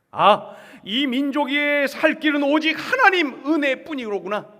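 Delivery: loud, high exclamation of faith; acted awed discovery; fervent realization. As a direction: cry out as the prophet who now understands. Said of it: "태도가 바뀐 거예요".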